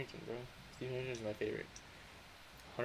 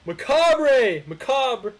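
loud, higher, drawn-out shoutout; hype shout; 7 semitones higher, 10 dB louder, much slower than usual. Real, not acted. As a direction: call the name out big. Hyped donation shoutout.

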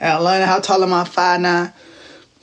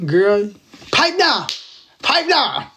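playful demo; much slower than usual. Shown as a demonstration. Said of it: "And then I'd be do this".

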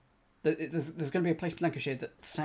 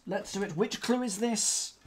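usual speaking voice

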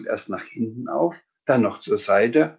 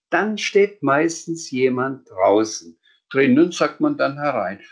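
higher voice reciting a rule singsong; know-it-all Sams; 5 semitones higher, 4 dB louder than usual. Acted. Sams repeating the knocking rule back, higher cheeky recital.

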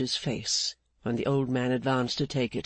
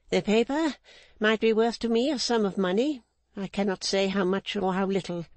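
Mrs Clennam; 7 semitones higher than usual. Acted. Mrs Clennam refusing Rigaud's demand, higher stern unbending woman. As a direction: hold the line like an unbending matriarch.

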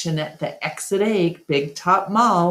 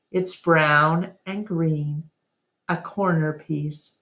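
plain reading voice

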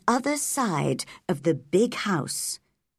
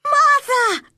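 put-on very high, loud girlish shout; shouted call; 8 dB louder than usual. A narrator's reading. Girl calling Martha on reaching her room, shouting. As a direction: call the name out at the top of a girl's voice. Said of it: "she shouted".

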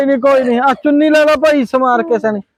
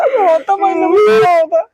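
higher, crying shout of mock grief; hammed-up crying wail; 7 semitones higher than usual. Consoling the weeping aunt, he breaks into hammed-up wailing. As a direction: burst into fake sobs, pitching the voice up.

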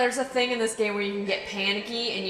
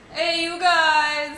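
slow, drawn-out bellow, higher and louder; Sloth; 6 semitones higher, 5 dB louder, much slower than usual. Retold or imitated. Gamer greeting the monster as Sloth, slow, loud drawn call. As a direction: call it out long like the Goonies' Sloth. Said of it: "It's Sloth".

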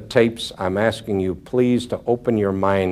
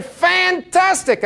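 overjoyed; much slower than usual. Retold or imitated. Overjoyed friend on phone.

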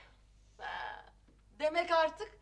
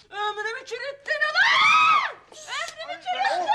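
furious screaming; 11 semitones higher, 6 dB louder than usual. Acted. Wife accuses husband of ruining her life, furious screaming. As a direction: scream the accusation, stretching the last word.

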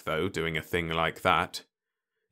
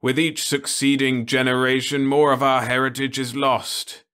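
higher, louder lament; mournful old man; 6 semitones higher, 6 dB louder than usual. Acted. Osmond laments the lost heritage to Ludovic. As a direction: grieve for what is lost.

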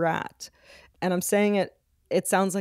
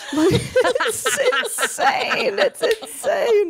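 high squealing laugh through words; much slower than usual. Real, not acted. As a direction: crack up while saying it. Laughing hysterically.

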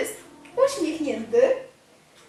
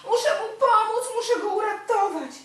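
higher, louder eager resolve; urgent, raised hero's thought; determined hero; 8 semitones higher, 4 dB louder than usual. Acted. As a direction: play the brave little hero deciding to help. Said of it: "myśli Antonino".

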